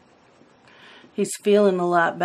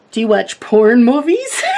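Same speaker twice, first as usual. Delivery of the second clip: louder, higher, laughter breaking in; laughing retelling; 4 semitones higher, 7 dB louder than usual. Retold or imitated. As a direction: quote the teasing question while cracking up. Laughing retelling of sister's teasing.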